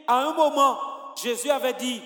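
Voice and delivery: ordinary delivery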